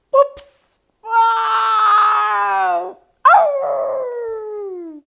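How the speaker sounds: high, loud shouted whoops and ouch; startled yelps. Acted. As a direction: cry out while falling over. Everyone tumbles over.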